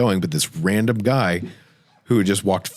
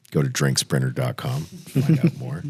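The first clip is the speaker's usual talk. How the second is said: ad plug; 3 semitones lower than usual. Acted. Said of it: "plugs sponsor site mid-story".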